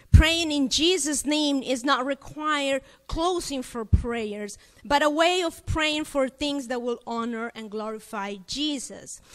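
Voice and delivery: normal talking voice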